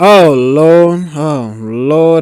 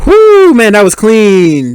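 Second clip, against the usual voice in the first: a high whoop, then slow, loud praise; real thrill at the driving; excited whoop; 5 semitones higher, 8 dB louder, much slower than usual. Real, not acted. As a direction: cheer the clean move. Reacting to a clean drift.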